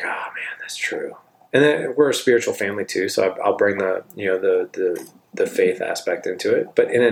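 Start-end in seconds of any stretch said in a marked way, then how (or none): 0.0-1.2 a whisper, breathed out as it dawns on him; hushed realization. Retold or imitated. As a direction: let it land softly, like a quiet oh. Son realizes, quietly.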